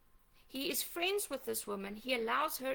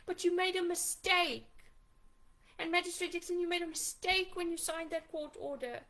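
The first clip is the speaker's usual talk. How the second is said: bitter accusation; 5 semitones higher than usual. Real, not acted. Mother confronting magistrate and captain.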